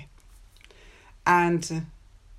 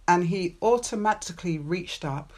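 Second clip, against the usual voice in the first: ordinary delivery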